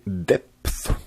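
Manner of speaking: drawn-out English word in a French accent; careful attempt at English with a French accent. Real, not acted. Tutorial host naming an image file, French-accented English. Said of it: "super accent anglais je sais".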